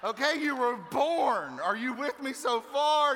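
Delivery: loud, slow, high-pitched insistence; comic exasperation. Pretend. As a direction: drag it out loudly for a slow class. Flustered, labours the point loudly.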